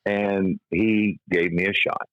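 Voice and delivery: normal talking voice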